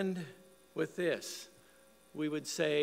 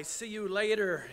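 raised, louder call; retold yell, pitched up; yelled goodbye; 6 semitones higher, 6 dB louder than usual. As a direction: call out a farewell to the grandkids. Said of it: "We would yell".

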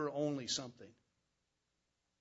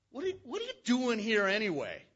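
louder, a touch higher, scolding big-brother tone; mildly angry demand; 6 semitones higher, 6 dB louder than usual. Acted. Brothers confronting David at the camp, mildly angry. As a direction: snap at the little brother. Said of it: "they demanded".

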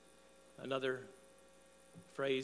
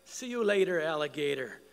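retold yell, loud and raised; yelled farewell; 7 dB louder than usual. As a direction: holler a playful goodbye after a car. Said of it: "my wife and I would yell out".

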